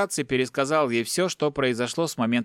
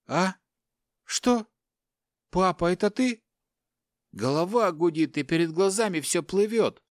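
put-on higher, confused young man's voice; dazed young son; 7 semitones higher than usual. Son waking bound, asking his father confused. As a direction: sound lost and groggy, pitched up.

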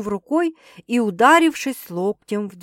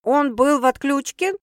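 teasing, slightly raised question; ironic; 5 semitones higher, 3 dB louder than usual. A narrator's reading. Sister guessing at what happened, ironic. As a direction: ask it with mild irony. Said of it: "иронично предположила сестра".

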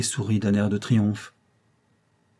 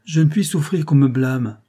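higher, louder, sharply clipped off; curt, dry retort; 5 semitones higher, 5 dB louder than usual. A narrator's reading. Rose to André, stung by blame, curt and sharp. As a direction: snap back, stung by the blame. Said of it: "dit sèchement".

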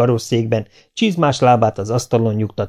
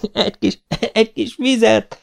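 high, choking laughter through words; két kacaj között; 12 semitones higher than usual. A narrator's reading. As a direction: gasp for water between laughs. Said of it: "szólt a fiúnak két kacaj között". (nevetéstől fuldokolva, magas hangon)

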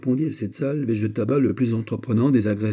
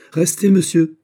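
loud, higher commanding order; imperious; 9 semitones higher, 9 dB louder than usual. A narrator's reading. General halts the Frenchman rushing off, imperious. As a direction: stop him with an imperious order. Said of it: "dit impérieusement Monk".

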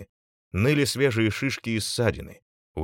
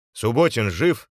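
louder, raised question called across distance; urgent call, moderately loud; 3 semitones higher, 6 dB louder than usual. A narrator's reading. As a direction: shout to find out who survived. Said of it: "крикнул Кольцов".

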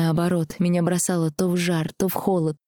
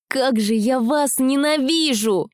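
angry; 8 semitones higher, 4 dB louder, slower than usual. Acted. Heroine confronts Rastus in fury.

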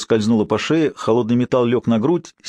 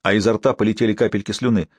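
ordinary delivery, nothing marked

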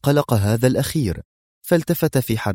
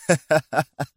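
high, acted scornful laugh; mocking laugh; 6 semitones higher than usual. A narrator's reading. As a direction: laugh in your enemy's face. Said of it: "قاطعه أدهم بضحكة عالية ساخرة". (ضحكة ساخرة مصطنعة)

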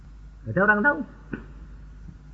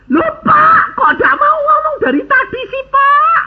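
much higher, louder mock protest; dismayed outcry; 4 dB louder than usual. Seller scolding the preacher for hiding his name, high loud outcry. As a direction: cry out in comic dismay.

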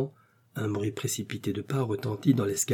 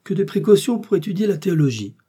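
high, loud exclamation; theatrical outburst, pitched up; exclaiming; 9 semitones higher, 7 dB louder than usual. A narrator's reading. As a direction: cry out in exaggerated amazement. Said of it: "s'écria Porthos".

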